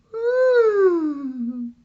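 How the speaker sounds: put-on very high little girl voice; high, greedy little girl; Goldilocks. Goldilocks smelling pudding, very high little girl voice.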